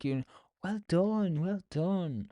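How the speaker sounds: high, slow, sing-song praise; patronizing dentist. Retold or imitated. Dentist praising a patient patronizingly, mock high sing-song. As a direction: talk down to him like a child.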